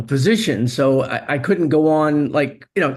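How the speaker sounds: normal talking voice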